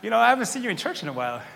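fast, mock-sweet church guilt trip; nice guilt trip. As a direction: needle them sweetly, lightly. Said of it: "guilt tripping you in a nice way".

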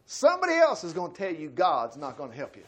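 normal talking voice

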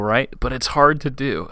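ordinary talk, nothing marked

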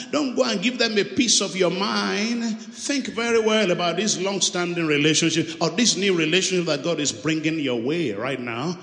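normal talking voice

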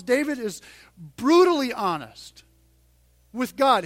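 ordinary talk, nothing marked

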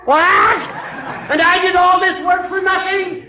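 slow, high, indignant shout; outraged shout. Acted. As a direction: bellow it in disbelief. Judge learns watch was found.

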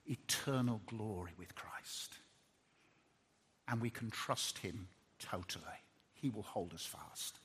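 hushed, breathy near-whisper; softly whispered hope. Real, not acted. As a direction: drop to a whisper of awe. Closing hushed reflection.

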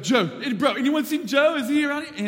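high, loud panicked surfer calling out; panicking bro. Retold or imitated. Guide frantically searching the river for Joe, panicked high shout. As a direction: yell for the missing kid in a frantic surfer voice. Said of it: "super chill raft bro is suddenly panicking".

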